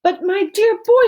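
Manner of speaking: high, loud joyful outburst; excited cry. A narrator's reading. Wonka bursting with joy at Charlie's win. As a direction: burst out with delight. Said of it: "he cried out".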